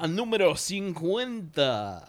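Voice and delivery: slow, high, drawn-out welcome; big showman welcome; hammed-up announcer. Show intro in Spanish.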